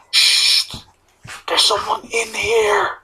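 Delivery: urgent, drawn-out stage whisper; hushed warning. Acted. Player signals Ridu that someone is inside, whispered warning. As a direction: shush them, then whisper it slowly. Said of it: "and I just signal".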